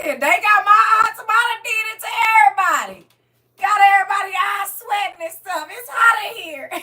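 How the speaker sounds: high, loud crying voice. Real, not acted. Blaming Tamara for making everyone cry, crying loudly. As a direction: sob it out. Crying again about gifts.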